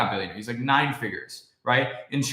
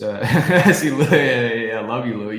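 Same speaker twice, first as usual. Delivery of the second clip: laughing; 7 dB louder, slower than usual. Real, not acted. Laughs at chat viewer.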